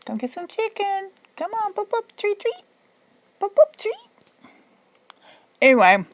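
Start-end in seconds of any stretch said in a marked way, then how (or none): 0.1-4.5 very high, sing-song dog calling; pet talk. Real, not acted. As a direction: coax the dog with treats.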